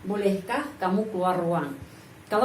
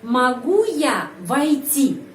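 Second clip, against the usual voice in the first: slow, drawn-out "Могу я войти" with "Могу" stressed; question intonation demo; 2 semitones higher, 3 dB louder, much slower than usual. Shown as a demonstration. Teacher repeats the question for learners, slowly stressed on "Могу". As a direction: stretch it out for learners, leaning on "Могу". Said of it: "Penekanan intonasi berada pada kata".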